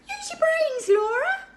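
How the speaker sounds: a bossy little know-all; impatient baby George. A narrator's reading. Baby scolding sister for a silly idea, impatient.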